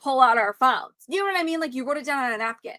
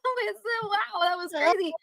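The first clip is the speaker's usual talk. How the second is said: laughing it off; 10 semitones higher than usual. Acted. Woman showing how she defuses a scary man, mock laughing it off. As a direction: act out a light nervous laugh-it-off. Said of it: "you just laugh it off".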